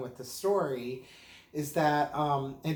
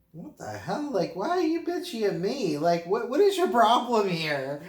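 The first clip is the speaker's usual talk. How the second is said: higher and louder, mock offended outburst; mock indignation; 7 semitones higher, 6 dB louder than usual. To his aunt on the phone, mock indignant outburst. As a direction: play up being wrongly snapped at. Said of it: "And I'm like".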